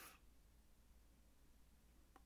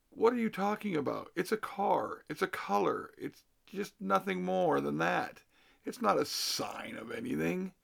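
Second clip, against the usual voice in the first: higher and faster, incredulous; exasperated disbelief; 6 semitones higher than usual. Retold or imitated. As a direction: rattle it off, unable to believe him. Retold argument over the car.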